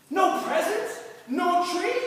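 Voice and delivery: loud, high jeering questions; mocking call-out. Classmate calling out mocking questions at the boy. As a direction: jeer at the kid in front of class. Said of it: "he calls out to me".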